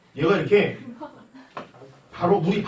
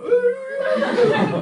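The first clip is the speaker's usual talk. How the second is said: mock wailing; 5 dB louder than usual. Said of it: "그래서 막".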